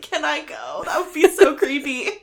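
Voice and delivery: high, giggly self-quote; laughing. Retold or imitated. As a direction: laugh through the words. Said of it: "And I was like".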